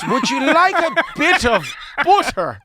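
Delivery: high, loud Dublin lilt, played up; Irish accent. Dublin shop guy offering butter in a hammed-up Irish accent. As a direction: ham up a lilting Irish offer.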